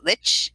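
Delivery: "lich" ending in a ch, like rich; pronunciation lich. Shown as a demonstration. Reader asking how lich is pronounced, ch ending. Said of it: "what is the proper pronunciation".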